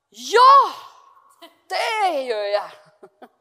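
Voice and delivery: a loud, keen exclamation that slows into laughter; eager yes. Retold or imitated. Anne eagerly accepting the Monday group, loud and laughing. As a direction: shout your eager agreement. Her eager reply, retold.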